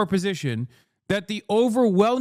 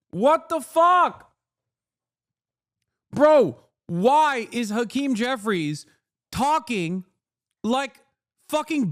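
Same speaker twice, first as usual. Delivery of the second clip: exasperated outburst; 3 semitones higher, slower than usual. Real, not acted. Outraged reaction to political clip.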